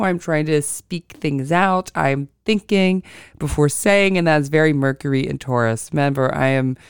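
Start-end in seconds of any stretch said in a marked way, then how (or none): 0.0-4.2 slow, deliberate, thinking before each phrase; slower speech. Real, not acted. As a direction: take your time, weigh each word. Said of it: "I am going much slower with my speech".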